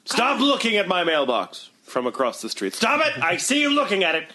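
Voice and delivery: high, indignant mock telling-off; indignant yelling neighbour. Neighbour to someone eyeing the mailbox, indignant yelling. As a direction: call out crossly to a nosy onlooker. Acting out a watched mailbox owner.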